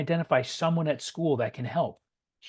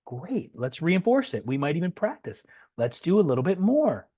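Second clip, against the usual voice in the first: put-on much higher, louder, beaming praise for the child; enthusiastic praise; 6 semitones higher, 3 dB louder than usual. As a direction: heap praise on the kid like a proud coach. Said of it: "Praise it".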